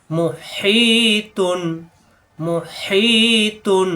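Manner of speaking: মুহিতুন with হি drawn out one alif, slow; stretched vowel. Shown as a demonstration. Teacher reciting মুহিতুন slowly for students. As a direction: hold the হি in মুহিতুন. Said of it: "হাসাব দিয়ে এক আলিফ টান".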